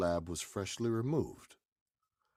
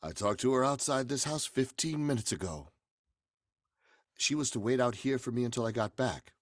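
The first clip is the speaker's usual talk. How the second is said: louder, pressing questions; urgent demand; 8 semitones higher, 6 dB louder than usual. Acted.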